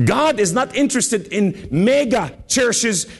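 high, urgent pulpit delivery; fervent preaching. Real, not acted. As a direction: drive the point home to the congregation. Preaches at full force.

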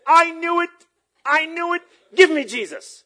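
high, loud acted exclamation; urgent high-pitched yell; excited outcry. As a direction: cry out as the thrilled woman, imitating her. Acts out the excited woman.

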